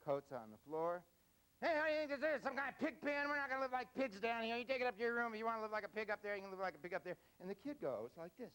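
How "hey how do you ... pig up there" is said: high, loud mocking father's rant; hammed-up furious father; scolding dad. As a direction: rant at the teen like an angry dad. Dad scolding teen again.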